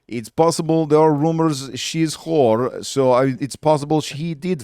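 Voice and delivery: hammed-up Kazakh accent; Borat accent. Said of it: "It's a Borat joke".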